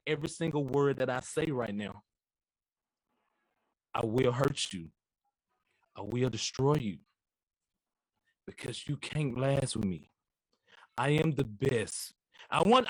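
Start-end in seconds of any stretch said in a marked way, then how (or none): none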